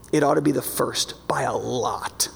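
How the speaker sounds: hushed whisper, pitch drops low; whispered emphasis. Real, not acted. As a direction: lean in and whisper it. Aside for emphasis.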